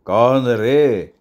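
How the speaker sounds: very slow, drawn-out echo; mocking echo. Gang boss throws the old man's question back, mocking. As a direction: stretch each word out in mock question.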